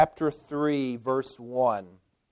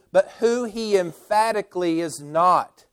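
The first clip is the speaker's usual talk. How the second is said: usual speaking voice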